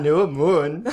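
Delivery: mock reversed speech; imitating a backwards recording; fake backwards. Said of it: "I like fake backwards".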